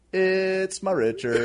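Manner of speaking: drawn-out slow reveal; slow punchline. Retold or imitated. Explaining the missed joke to Steve, slow drawn-out punchline. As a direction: land it slowly, word by word. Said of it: "and you say".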